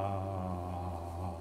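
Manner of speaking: long, low, soft "ah"; sustained vocal note. Shown as a demonstration. Acting teacher demonstrating a character's notes, long low tone. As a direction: hold one low note and let it fade. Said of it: "How many notes, meaning".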